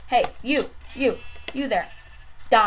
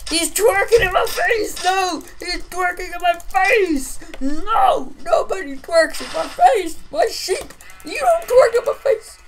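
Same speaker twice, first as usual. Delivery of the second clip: high, loud, mock-furious rant; high-pitched indignant shouting at a taunt; outraged yelling; 7 semitones higher, 7 dB louder than usual. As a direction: yell in hammed-up outrage.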